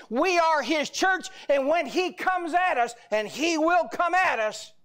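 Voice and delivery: forceful, driving sermon delivery; full-force preaching. Acted. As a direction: proclaim defiance with every phrase punched.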